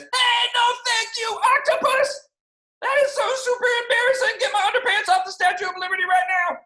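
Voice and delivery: put-on high, loud protest of an embarrassed boy; embarrassed yelling. A narrator's reading. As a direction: shout it up high, mortified.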